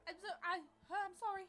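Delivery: flustered apology. Acted. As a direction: stumble over the words, startled. Bumped stranger, stammers apology in character.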